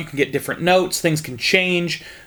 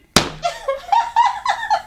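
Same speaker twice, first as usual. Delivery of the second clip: high laugh. Real, not acted. Laughing at own hillbilly bit.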